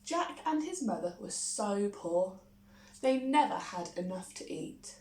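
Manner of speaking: ordinary delivery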